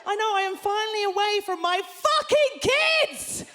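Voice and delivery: high, loud cry of freedom; elated shout. Real, not acted. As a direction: holler it to a cheering crowd. Celebrating to the crowd, night out.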